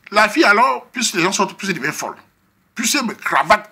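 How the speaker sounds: ordinary talk, nothing marked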